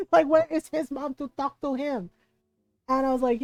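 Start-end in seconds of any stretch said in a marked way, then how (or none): 0.3-2.1 higher, louder mock indignation; imitating mad mom. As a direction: play an outraged mother wanting a word. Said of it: "She was so mad".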